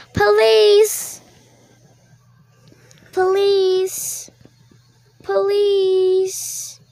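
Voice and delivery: shouting for the police across the room; calling for police. Pretend. Kid playing victim calls for the police.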